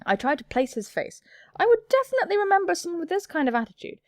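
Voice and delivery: ordinary talk, nothing marked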